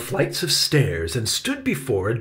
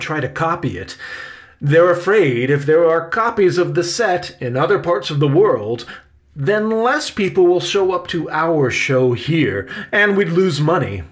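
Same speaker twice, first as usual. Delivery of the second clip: loud, higher-pitched uncle character; explaining uncle; 7 semitones higher, 7 dB louder than usual. A narrator's reading. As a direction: project it, explaining rules to a nephew.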